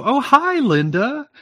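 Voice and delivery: high sung hello; singsong women's greeting. Retold or imitated. Women greeting a friend, high singsong. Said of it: "they go".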